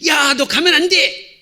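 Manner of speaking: high, drawn-out warning shout; reenacted parent's shout of alarm; retold yell. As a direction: call out urgently to stop a child. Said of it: "제가 소리를 질렀어요".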